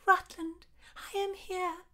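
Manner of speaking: put-on high, airy young woman's voice; lovestruck heroine. A narrator's reading. Heroine answers her lover at the window, high and lovestruck.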